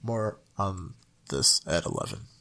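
low, serious newscaster voice; news anchor. Pretend. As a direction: deliver it like breaking news. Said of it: "I'm Walter Conkright".

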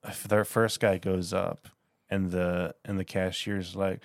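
usual speaking voice

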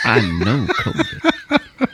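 slow, low, through a laugh; buddy insisting, laughing. Retold or imitated. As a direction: drag it out slowly while laughing.

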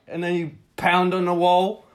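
ordinary delivery

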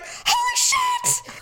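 high, drawn-out mock scream; shocked scream. Retelling her kitchen cut, high drawn-out shriek. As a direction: shriek it high, stretching both words. Retold reaction to cutting herself.

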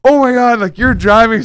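high, loud retold panic; sudden alarmed realization. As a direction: blurt it out as if suddenly remembering the wheel. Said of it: "all of a sudden I realize".

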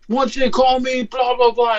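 high mocking imitation of a driver; pushy, high put-on voice; in-your-face tow driver. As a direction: mimic the tow guy getting in your face. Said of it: "He, like, gets in my face".

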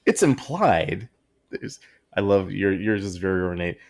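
ordinary delivery

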